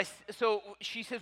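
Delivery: normal talking voice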